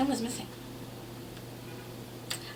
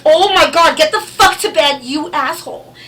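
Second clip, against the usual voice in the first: much louder and higher, half scared and half furious; startled yell; 8 semitones higher, 12 dB louder than usual. Retold or imitated. Mother startled by her son, yelling him off to bed. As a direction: shout him off to bed in shock.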